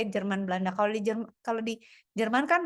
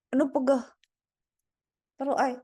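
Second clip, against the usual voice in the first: aching groan on the complaint; sore muscles; faster than usual. Real, not acted. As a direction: wince as if your body aches. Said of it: "[name] kepegelan". (mengeluh pegal)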